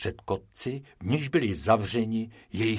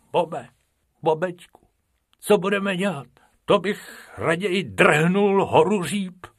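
higher, whiny rabbit voice; Bobek whining; 9 semitones higher, 4 dB louder than usual. Acted. Bobek moaning to Bob about the elephant, whiny. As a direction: complain to a friend.

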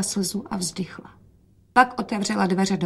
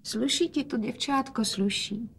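soft, higher, tender-quiet praise; quietly; 3 semitones higher, 7 dB quieter than usual. A narrator's reading. Father praising daughter, quietly. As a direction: speak softly, pitched up. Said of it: "hovořil otec tiše".

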